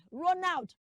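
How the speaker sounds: Ronald as "ro-nowt"; long O in Ronald, "nowt" at the end; wrong pronunciation. Shown as a demonstration. Teacher demonstrates the mistake, Ronald as "ro-nowt". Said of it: "That's wrong".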